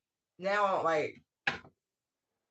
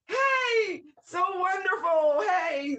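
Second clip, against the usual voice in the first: much higher, louder sing-song sweetness; mock sugary greeting; 11 semitones higher, 4 dB louder, much slower than usual. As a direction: ham up a gushing nice-lady hello. Mocking an overly nice persona.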